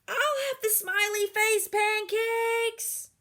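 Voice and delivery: high, slow, loud order; loud child voice. Acted. Girl ordering pancakes far too loud. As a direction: announce the order to the whole diner.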